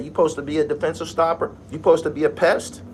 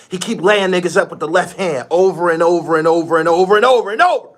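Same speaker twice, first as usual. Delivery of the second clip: loud, climbing higher on each repeat; exasperated rant; 5 semitones higher, 8 dB louder, faster than usual. Real, not acted. Fed-up fan ranting about left-hand layups, rising exasperation. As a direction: build to a high, loud peak of exasperation.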